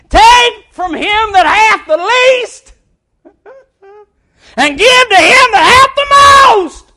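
loud, high, slow proclamation; proclaiming shout. Acted. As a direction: thunder it out as a judgment. Jesus proclaiming judgment, shouted.

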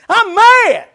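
high, loud mock fury; exaggerated shout of "mad"; hammed-up angry Samson. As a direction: throw the tantrum big. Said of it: "That's his attitude".

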